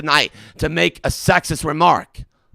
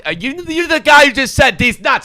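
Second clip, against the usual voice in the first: furious shout; 11 semitones higher, 7 dB louder than usual. Real, not acted. Host yells at prank caller.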